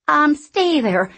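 slow, high, loud telling-off; scolding. Acted. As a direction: scold the log sharply. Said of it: "she scolded".

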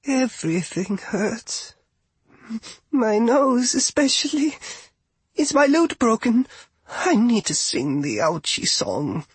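a hurt little bard whining; high whimpery rabbit girl. Acted. Rabbit bard wakes injured.

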